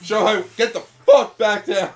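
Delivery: loud, high yell at a character; shouting. Pretend. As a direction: bellow the order. Yelling at a character.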